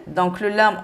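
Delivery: normal talking voice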